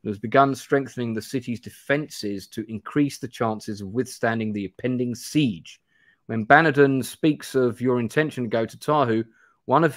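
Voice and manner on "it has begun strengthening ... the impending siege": ordinary delivery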